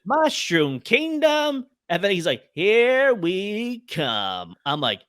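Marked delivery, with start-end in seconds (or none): 0.1-1.7 high, slow cartoon Mario voice; mock Italian plumber announcing the kingdom. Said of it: "it's just his voice". 2.5-4.6 very slow, unbothered everyman voice; deadpan imitation of Chris Pratt's plain voice; flat Chris Pratt. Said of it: "it's just his voice".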